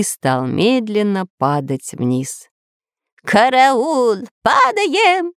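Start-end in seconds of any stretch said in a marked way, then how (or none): 3.2-5.3 high-pitched cry of alarm; panicked scream. A narrator's reading. Spy Dyrka panics as the ship falls, high scream. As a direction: shriek for help as the ship drops. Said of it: "закричал шпион Дырка".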